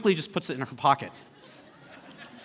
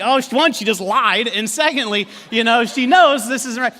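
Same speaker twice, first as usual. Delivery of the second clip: raised, higher, incredulous voice; amused indignation; 5 semitones higher, 6 dB louder than usual. Retold or imitated. Husband reacts to his wife's lie, loudly incredulous. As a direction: exclaim it as if catching her out.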